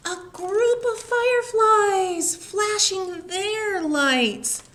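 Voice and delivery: put-on slow storybook wonder for children; delighted wonder. A narrator's reading. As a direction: reveal it like a treasure. Joyful reveal to children.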